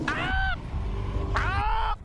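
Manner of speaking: very high short screams; panicked shrieks. Real, not acted. Player begging for it to end, panicked shrieks. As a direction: shriek high, in quick alarm. Hoping it is the last one.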